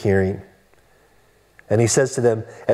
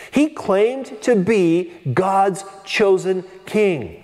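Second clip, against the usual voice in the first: slow emphatic point; 3 semitones higher, much slower than usual. Real, not acted. Preacher drives home key point.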